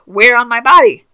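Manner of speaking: loud, high exasperated demand. Acted. Confused user questioning the instructions, loudly exasperated. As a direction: cry out at useless instructions.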